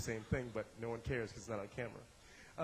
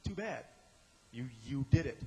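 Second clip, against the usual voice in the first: usual speaking voice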